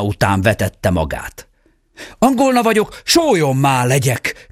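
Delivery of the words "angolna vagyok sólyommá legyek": much higher urgent cry; exclamation. A narrator's reading. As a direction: call the spell out in flight. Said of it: "kiáltott a fiú". (sokkal magasabb, sürgető kiáltás)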